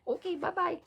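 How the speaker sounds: high, cute "bye-bye" in Mara's voice; Mara's sweet goodbye. Retold or imitated. Mara replying to the recording text, in a high, cute voice. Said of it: "God, I love her".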